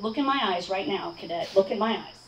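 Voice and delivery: lightly voiced low, controlled instructor; no-nonsense instructor. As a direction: give a restrained, low-pitched command as the instructor.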